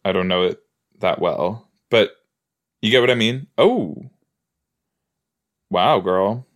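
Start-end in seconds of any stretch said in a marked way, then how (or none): none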